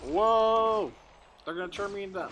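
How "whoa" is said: loud, high startled whoa; surprised. Real, not acted. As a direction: caught off guard, shout it out. Frozen by enemies in game.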